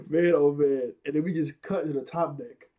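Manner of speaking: usual speaking voice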